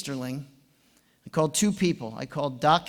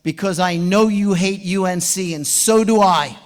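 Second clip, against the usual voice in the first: louder, punching each word; young coach firing back at the rival, louder; emphatic retort; 3 semitones higher, 8 dB louder than usual. Retold or imitated. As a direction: blurt out the one argument that might win him over. Pleading comeback on phone.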